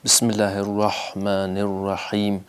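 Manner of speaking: measured tajweed chant; Quranic recitation. Shown as a demonstration. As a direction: chant it like a reciter modelling for class. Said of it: "رحمان میں ہا میں الف کا بھی امکان ہے".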